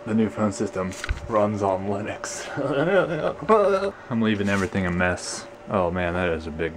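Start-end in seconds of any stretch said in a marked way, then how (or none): 2.5-4.0 high-pitched goofy laugh; high silly laugh. Pretend. Tech showing off the new phone system, laughing high. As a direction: laugh way up above your normal voice. Laughs after saying it runs Linux.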